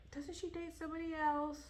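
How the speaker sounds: half-remembering the plot aloud; mock puzzled question. Reader recalling the plot mid-book, a mild puzzled question. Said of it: "I was like".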